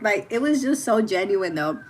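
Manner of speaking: ordinary delivery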